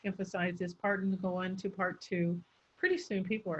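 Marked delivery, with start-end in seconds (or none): 0.0-2.4 monotone lecturer running the phrases together; droning speaker. Acted. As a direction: drone on with no change of intonation. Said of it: "speakers who drone on".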